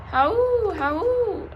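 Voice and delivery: high, loud howls that fade; distant wolves howl back; answering wolf howls. A narrator's reading. Wolves answering the dog, high howls fading. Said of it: "something howled back".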